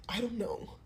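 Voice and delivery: a soft, defeated whisper; a hushed, tearful I don't know. Retold or imitated. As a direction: whisper it like you're giving up. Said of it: "I'm trying not to cry".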